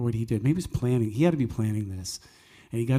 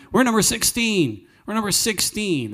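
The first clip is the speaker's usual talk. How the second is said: proud announcement; 10 semitones higher, 5 dB louder than usual. Acted. Proud announcement of tour number.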